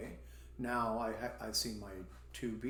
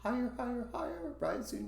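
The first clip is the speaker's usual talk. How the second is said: "higher" stepping up in high pitch; teacher's pitch-ladder on "higher"; rising intonation; 12 semitones higher, faster than usual. Shown as a demonstration. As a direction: climb with each "higher". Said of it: "That's just rising".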